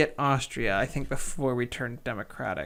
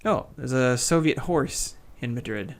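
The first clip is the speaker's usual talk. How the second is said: ordinary talk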